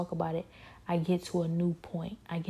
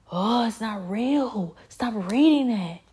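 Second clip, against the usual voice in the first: loud, slow and higher, snapping at him to stop reading; angry demon voice; 4 semitones higher, 8 dB louder, slower than usual. Retold or imitated. Demon yelling at him to stop reading, loud angry voice. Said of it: "They started to get very angry".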